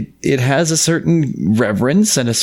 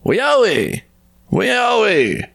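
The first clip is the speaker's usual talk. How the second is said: high ringing call across the land; 12 semitones higher, much slower than usual. A narrator's reading. Spirit calling the trapped serpent, high ringing call. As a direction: call his name out high and long.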